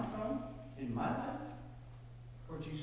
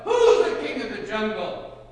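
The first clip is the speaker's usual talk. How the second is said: very loud, high bellow; booming, boastful challenge; bellowing lion; 7 semitones higher, 13 dB louder than usual. A narrator's reading. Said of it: "the lion bellowed".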